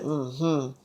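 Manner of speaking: very quiet, low hum; soft agreement. Retold or imitated. Selena agrees privately with her lover, soft agreement. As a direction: murmur assent, low and intimate.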